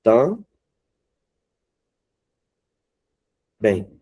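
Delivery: "Tam-, bem" in two separate beats; teacher breaking "Tam-bem" into syllables. Shown as a demonstration. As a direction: split TAM and BEM with a long pause. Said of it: "o bem é mais forte".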